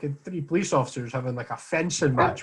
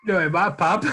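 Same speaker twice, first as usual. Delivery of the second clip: hooligan voice; 4 semitones higher, 4 dB louder than usual. Retold or imitated. Voicing the hooligan again.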